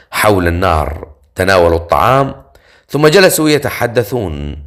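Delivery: usual speaking voice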